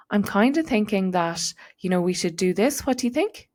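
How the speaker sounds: rising, unsure question tune on every phrase; hesitant upspeak. Shown as a demonstration. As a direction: sound like you're asking permission. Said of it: "we raise the intonation of our voice".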